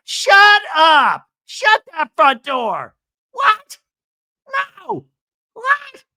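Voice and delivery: shouted high, then piercing one-word outbursts; over-the-top excitement. Retold or imitated. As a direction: imitate someone losing it in disbelief. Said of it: "all of a sudden is on a twenty".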